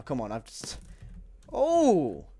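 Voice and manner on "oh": high, loud "oh"; startled yell. Real, not acted. Gamer hit during the bow fight, startled yell. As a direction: yelp out in surprise.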